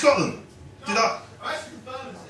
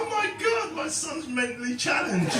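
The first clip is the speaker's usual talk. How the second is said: much higher, half-shouted panicked thought; comic acts out his horror at his son stroking the screen; mock alarm; 8 semitones higher, 6 dB quieter than usual. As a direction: yelp it up high in fake horror. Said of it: "I thought".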